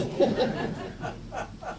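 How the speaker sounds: breathy soft laugh; soft amused chuckle; real laugh. Real, not acted. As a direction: laugh quietly to yourself. Laughing at the punchline.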